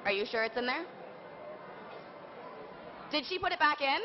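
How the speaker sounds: usual speaking voice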